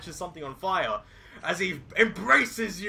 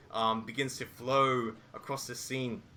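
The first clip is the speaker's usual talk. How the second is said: normal talking voice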